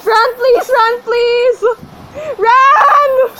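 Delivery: high, loud, desperate shouts; frantic begging. Real, not acted. Player begging the game for Ran, frantic shouting. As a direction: plead at the top of your voice.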